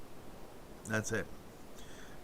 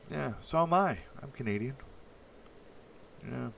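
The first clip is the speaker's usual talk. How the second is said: normal talking voice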